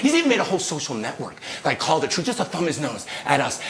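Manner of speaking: normal talking voice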